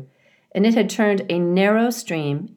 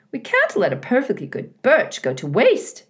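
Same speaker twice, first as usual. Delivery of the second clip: higher, brisk beaver wife voice, a little louder; Missus Beaver; 7 semitones higher, 3 dB louder than usual. A narrator's reading. Missus Beaver overrules her husband, high and firm. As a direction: talk him round, high and firm.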